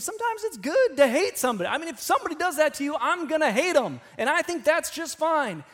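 high, loud, defiant retort, a bit hammed up; defiant guest. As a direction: play the guest defending his grudge. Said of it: "He goes".

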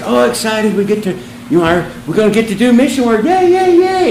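hammed-up cheer like kids running downstairs; mock giddy excitement. Mocking eager young missionaries.

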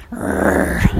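low throaty growl. Real, not acted. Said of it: "I'm growling tonight".

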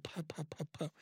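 soft puffing snore noises through the lips; imitated snoring. Sneaking past the drunk manager as he snores, soft puffed snore. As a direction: puff out a drunk man's sleepy snore, very soft.

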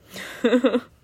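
loud, high laugh; burst of laughter. Real, not acted. Laughing at Asumi's sleepy joke, loud burst of laughter. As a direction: let out a real laugh.

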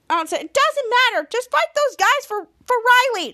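high, hurried urging; acted exasperation. Schwoz urges a fight for Riley, high mock exasperation. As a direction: imitate an impatient order to get fighting.

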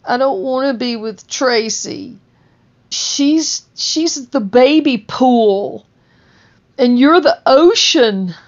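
put-on high pleading voice, baby pool line; ex's confession. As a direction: raise the voice up high for the ex. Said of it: "all of a sudden she says".

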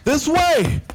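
high, loud, rushed calls; urgent shouts on the run; shouting while running. Acted. Running to catch the flight.

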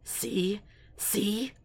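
soft, hissed question, pitched a bit up; grinning hiss. A narrator's reading. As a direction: hiss it softly through a grin. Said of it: "Hobbs is grinning and hissing".

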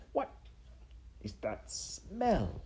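slow, halting question, swooping up on smell; puzzled sniffing. Acted. Rat sniffs at the mouse's whiskers, puzzled and slow. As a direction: break after "what", then stretch "smell" wonderingly. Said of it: "Roscuro sniffed".

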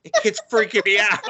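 put-on high, giggly creeped-out child; laughing mock kid. Children spooked by the staring boy, high and laughing. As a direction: voice the spooked kids through laughter. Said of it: "they're like".

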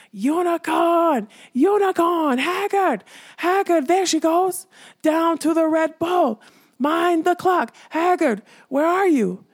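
high, loud shrieking alarm; shrieking skull. Acted. Skull betraying the fleeing unicorn to the king, shrieked high. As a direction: screech it out like a mad skull raising the alarm. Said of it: "And the skull shrieked".

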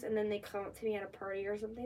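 normal talking voice

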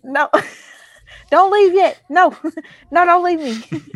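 loud laughing pleading; laughing plea. Real, not acted. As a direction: beg through laughter. Begging co-host not to leave.

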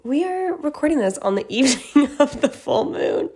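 laughing through the line. Real, not acted. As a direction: giggle your way through it. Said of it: "retake, laughing through it".

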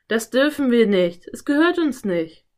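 loud, insistent refusal; firm scolding, climbing in pitch; worried objection. A narrator's reading. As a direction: protest that it is forbidden. Philipp objects, alarmed.

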